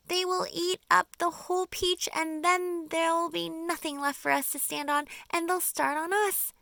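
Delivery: high, loud cry of despair; a spider crying out in panic. Acted. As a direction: wail that all is lost. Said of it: "cried Miss Spider".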